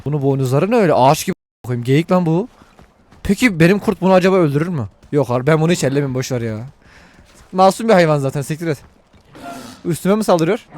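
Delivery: ordinary delivery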